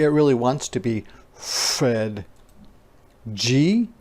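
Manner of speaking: ordinary talk, nothing marked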